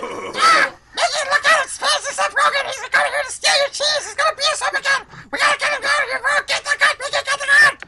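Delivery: high, loud panicked duck squawk; Donald Duck voice. Acted. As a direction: shriek it like a frantic cartoon duck. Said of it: "This is Donald Duck".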